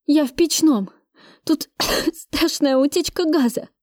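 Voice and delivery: high, urgent report broken by a cough. A narrator's reading. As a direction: choke out an alarm, coughing mid-sentence. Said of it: "кашля проговорила я". (сквозь кашель, с тревогой)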